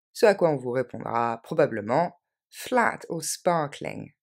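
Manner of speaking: normal talking voice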